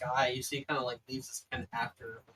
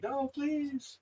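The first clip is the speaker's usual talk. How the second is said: high-pitched hammed-up begging; theatrical, drawn-out plea; protesting plea; 13 semitones higher than usual. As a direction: wail a mock protest, pitched way up. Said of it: "starts to kinda fight and protest and argue".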